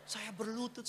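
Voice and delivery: ordinary talk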